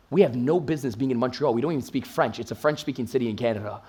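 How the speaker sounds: low, quiet, deflated grumble; subdued pushback; retold objection. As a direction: voice the reluctant friends, soft and low.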